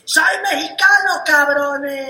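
mock child shouting at the interview; kid's proud shout. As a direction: belt it like a proud little boy. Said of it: "agarra y dice".

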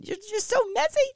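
messy squeaked way up high; exasperated. Real, not acted. Mom venting to viewers about the kids' mess, squeaky high pitch.

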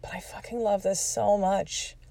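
pleading through tears, hushed; a quiet slowed-down cry of love for the sport; tearful plea. Retold or imitated. Crying, telling husband she loves it.

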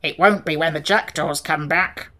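gruff growled warning; growling Sawhorse. A narrator's reading. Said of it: "growled the Sawhorse".

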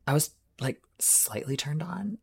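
whispered confession; sheepish whisper. Real, not acted.

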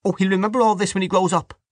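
high, heated retort; indignant woman's outburst; hot indignation. A narrator's reading. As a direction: flare up in a high voice. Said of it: "said Dante hotly".

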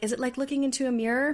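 lightly hammed-up eager question, fast; excited quote. Family asking, quoted.

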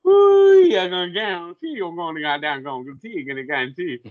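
mock Cajun chef, whooping then settling; Justin Wilson. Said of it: "Cajun chef Justin Wilson. It was like".

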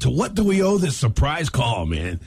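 normal talking voice

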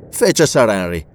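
ordinary delivery

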